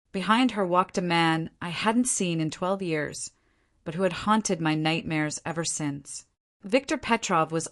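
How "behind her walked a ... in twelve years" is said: plain reading voice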